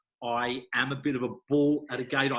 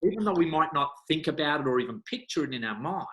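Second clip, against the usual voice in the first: normal talking voice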